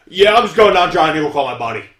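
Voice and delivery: lightly voiced loud local guy; voiced stranger. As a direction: call it out like a man outside the car. Acting out a stranded man.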